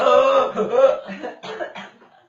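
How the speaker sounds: loud snorting gasping laugh. Retold or imitated. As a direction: imitate a kid laughing so hard he snorts. Son snorting laughing, imitated.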